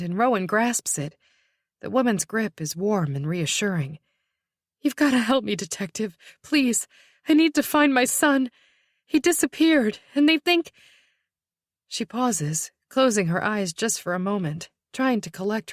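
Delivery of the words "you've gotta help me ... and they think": high, desperate plea; pleading. Acted. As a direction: beg urgently for help.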